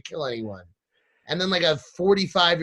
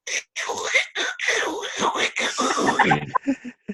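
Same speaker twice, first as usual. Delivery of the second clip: imitated poncho noise; 10 semitones higher than usual. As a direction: mimic plastic rustling with your mouth. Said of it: "the whole time it's just like".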